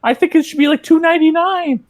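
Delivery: very high-pitched put-on customer voice; squeaky mock haggling. Thrift-shop customer arguing over a price, mock high-pitched.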